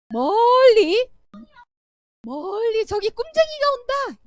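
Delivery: high, drawn-out jeering call from afar; mocking brothers. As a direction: call out slowly, sneering at the dreamer. Brothers see Joseph coming. (멀리서 오는 동생을 비웃듯 길게 외치는 목소리)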